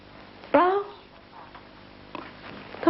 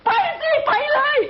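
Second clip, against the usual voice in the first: furious shout; 8 semitones higher than usual. Acted. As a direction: throw him out with a shout. Wife shouting at husband to leave. (ตะโกนไล่อย่างโกรธจัด)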